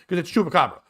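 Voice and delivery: Chupacabra said the Boston way, no r; imitating Boston accent on Chupacabra. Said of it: "With a Boston accent".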